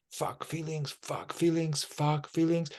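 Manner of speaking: hushed imitation of a thought; inner resisting voice. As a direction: murmur it softly, like a repeated inner thought. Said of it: "this voice is saying".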